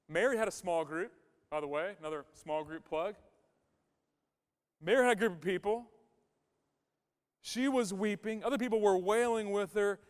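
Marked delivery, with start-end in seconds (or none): none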